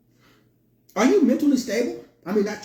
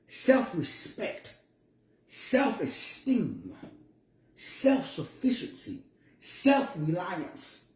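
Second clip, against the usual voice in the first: self stressed in self-respect, self-esteem, self-sufficiency, self-reliance, slow and softer; emphasis on self; 6 dB quieter, much slower than usual. Shown as a demonstration. Listing the keys to wealth, slow, soft, stress on self. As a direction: lean on self each time, slowly. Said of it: "Emphasis on the word self".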